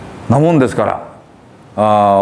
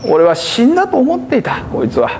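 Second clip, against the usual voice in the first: joyful father; 10 semitones higher than usual. Retold or imitated. Father voiced retelling his joy.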